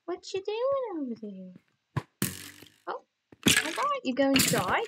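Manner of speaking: cooing at a creature. Pretend. Cooing at a mob in-game.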